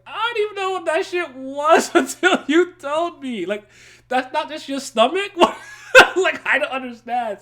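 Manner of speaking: incredulous, cracking up; laughing hard. Real, not acted. Cracking up retelling confusion.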